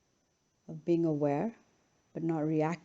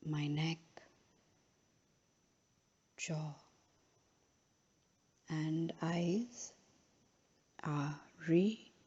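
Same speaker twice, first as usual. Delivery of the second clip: slow, softer meditation voice with long pauses; unhurried, measured phrases, each left to settle; guided relaxation; 7 dB quieter, much slower than usual. Real, not acted. Yoga teacher guiding a final relaxation in Shavasana, slow and calm.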